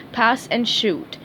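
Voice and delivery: plain reading voice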